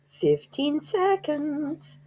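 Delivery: very high, very slow witch voice; witch counting down. A narrator's reading. Grand High Witch counting down to Bruno's change, high and drawn out. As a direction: drag it out like a gleeful witch. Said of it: "cried the Grand High Witch".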